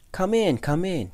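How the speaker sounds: high, loud, beaming invitation; excited host calling out a welcome; eager welcome. A narrator's reading. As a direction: usher a friend in joyfully, pitched high. Beaming mouse welcomes his friend.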